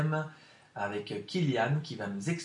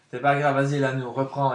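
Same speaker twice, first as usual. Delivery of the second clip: loud encouragement; 10 dB louder than usual. Real, not acted. Host cheers on hesitant pupil.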